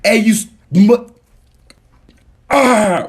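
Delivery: high, loud burst of cut-off insults; mock angry outburst. Somebody insults you at the door, mock angry outburst. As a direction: break off swearing into a yell.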